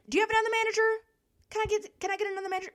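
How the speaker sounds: mock the customer asking for a manager; entitled customer. The old lady customer demanding another manager, mock-entitled.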